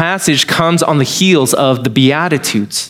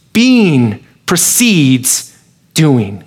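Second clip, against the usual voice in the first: slow deliberate; 2 semitones lower, much slower than usual. Acted. Key principle spelled out.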